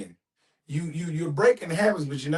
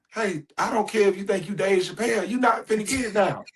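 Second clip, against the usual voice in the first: put-on raised-pitch scolding of an impatient kid; acted-out fatherly telling-off; stern dad; 6 semitones higher than usual. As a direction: lay down the law like a fed-up father. Father scolding his impatient child.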